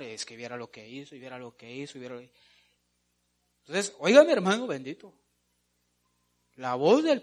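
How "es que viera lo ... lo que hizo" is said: low, hushed gossip, run on repetitively. Acted. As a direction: pass it on under your breath like a gossip. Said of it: "andar chismes de lugar en lugar". (chismeando en voz baja)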